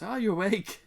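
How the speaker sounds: high, loud questioning greeting; greeting in character. Acted. Dallin passing in the hallway, high loud greeting. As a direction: call it up high as you pass.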